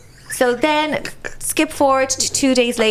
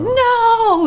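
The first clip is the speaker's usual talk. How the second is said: shocked high no; 12 semitones higher, 4 dB louder than usual. Retold or imitated. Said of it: "I was like".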